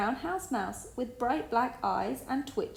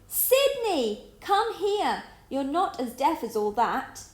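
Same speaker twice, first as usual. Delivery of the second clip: high loud yell; impatient yell, much higher; 6 semitones higher, 5 dB louder, slower than usual. A narrator's reading. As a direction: shout to make him hear. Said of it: "yelled Tom".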